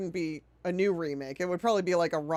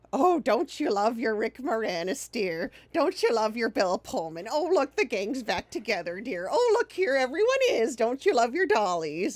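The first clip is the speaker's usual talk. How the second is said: put-on sweet, high, coaxing voice like coddling a child; mock-sweet talk to a child about dollies; cooing studio voice; 3 semitones higher than usual. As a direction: coo at the audience like they're toddlers with toys. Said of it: "it's like".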